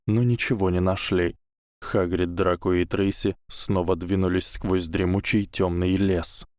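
ordinary delivery, nothing marked

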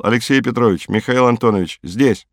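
ordinary delivery, nothing marked

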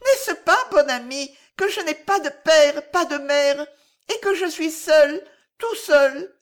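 put-on high, loud child pleading; lonely little boy. A narrator's reading. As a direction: plead as a small boy all alone. Child says he is alone. (voix aiguë et forte d'enfant esseulé)

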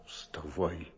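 hushed low urgent whisper; whispering while hiding. Acted. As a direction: urge him quietly so no one hears. Hushed urging while visitor waits.

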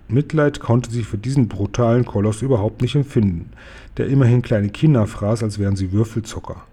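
ordinary delivery, nothing marked

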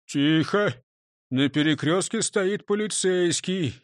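usual speaking voice

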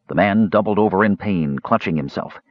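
ordinary delivery, nothing marked

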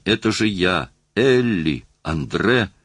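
plain reading voice

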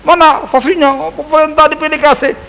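high, complaining mock voice of a worried villager; mocking the fearful ones who feel left out, high and whiny; whiny fearful complainer. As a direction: play the scared grumbler whining about being skipped. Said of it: "atau yang takut-takut".

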